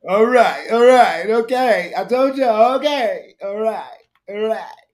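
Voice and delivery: high, loud, chirpy okay; mocking a politician's cheery okays; mock rally okays. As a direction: parrot her chirpy stage okays. Mimics Kamala's okays on stage.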